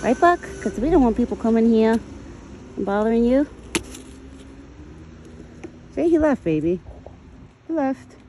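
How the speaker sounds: high, sweet voice calming a scared deer; soothing pet talk. Acted. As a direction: coo to the frightened deer. Reassuring the deer.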